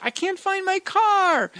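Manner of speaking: put-on high, slow voice of a lost elderly lady; woman at the grocery store, lightly voiced, lost and upset; distraught old lady. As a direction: plead like a confused widow who can't find her car.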